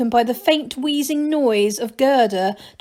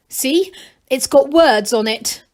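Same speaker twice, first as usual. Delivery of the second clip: proud; 3 semitones higher, 5 dB louder than usual. A narrator's reading. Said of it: "he said proudly".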